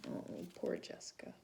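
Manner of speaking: soft breathy sympathy aside; whisper. Real, not acted.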